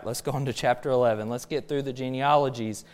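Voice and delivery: ordinary talk, nothing marked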